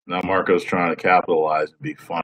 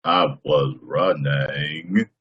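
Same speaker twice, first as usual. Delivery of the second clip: Forrest Gump impression; 4 semitones lower, much slower than usual. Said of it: "Tom Hanks I can do".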